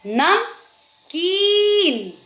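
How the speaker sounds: "नमकीन" slowly, "नम", pause, high drawn-out "कीन"; long ee. Shown as a demonstration. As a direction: stretch the ee in "नमकीन". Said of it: "बड़ी ई की मात्रा".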